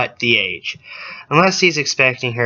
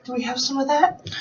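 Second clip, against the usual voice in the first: high and soft; 11 semitones higher, 6 dB quieter than usual. Real, not acted. Hopeful joke about brain bleach.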